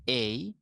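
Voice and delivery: এই drawn into an "ey-ee" glide; British-style gliding এই. Shown as a demonstration. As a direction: model এই as a long British diphthong. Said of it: "British pronounce হচ্ছে".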